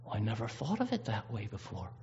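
soft and wondering, just tempted; lightly voiced Eve, taken in by the serpent. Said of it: "And Eve started listening".

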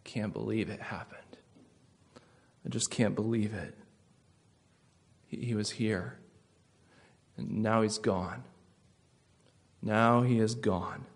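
hushed, grieving whisper fading to soft; sad disbelief. Retold or imitated. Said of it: "you can hear something sad, disappointed".

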